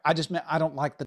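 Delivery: normal talking voice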